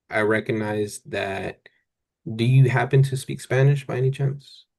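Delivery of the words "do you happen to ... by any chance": ordinary delivery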